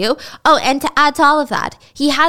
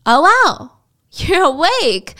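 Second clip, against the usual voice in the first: mock caught surprise; 5 semitones higher, 4 dB louder, slower than usual. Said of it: "Mel caught by surprise".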